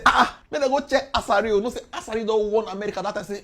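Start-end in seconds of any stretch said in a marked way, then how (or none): 0.1-1.6 mock jeering. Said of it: "Some people go dey say".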